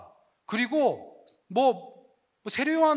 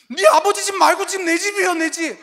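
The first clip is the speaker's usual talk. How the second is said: higher and louder frustrated retort; mock protest; 7 semitones higher, 6 dB louder than usual. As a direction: huff back like a fed-up young man. (답답해서 언성 높이는 청년)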